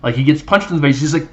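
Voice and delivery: ordinary talk, nothing marked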